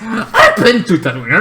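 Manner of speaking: laughing. Real, not acted. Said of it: "You know, right?".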